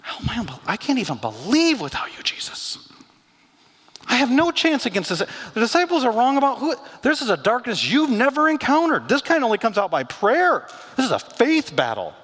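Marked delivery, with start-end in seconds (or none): 1.8-2.9 breathless whispered name; whispered prayer. Acted. 4.1-12.2 high, loud, driving preacher voice; full-force preaching. Acted.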